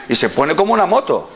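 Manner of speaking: ordinary delivery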